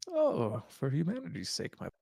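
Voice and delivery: put-on high quavering old man; frail elderly sigh.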